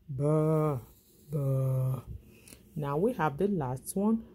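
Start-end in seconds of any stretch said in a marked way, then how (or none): 0.1-2.0 bleating "ba, ba"; sheep bleat. Shown as a demonstration. Teacher voicing the sheep again, bleating.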